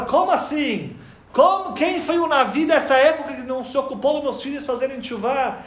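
high, loud disbelieving cry of how; shocked outcry. Acted. Avraham hears his children were exiled, shocked high outcry. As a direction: voice jumps up in alarm at the news.

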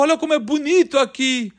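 a man crying alone in an empty church; crying lament. Retold or imitated. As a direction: complain between sobs about his misery. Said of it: "Enquanto eu chorava".

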